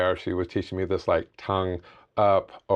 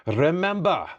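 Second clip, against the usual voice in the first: higher, badly faked Scottish accent; horrible Scottish accent; 6 semitones higher than usual. Imitating a cycling coach from Scotland, in bad Scottish. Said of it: "that's a horrible Scottish".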